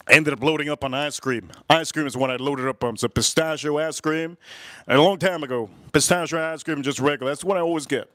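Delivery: normal talking voice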